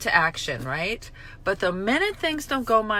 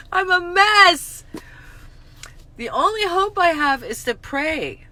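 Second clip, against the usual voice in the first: crying; 10 semitones higher, 8 dB louder than usual. Real, not acted. Stained clothes undo her, real crying. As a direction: let it break into tears.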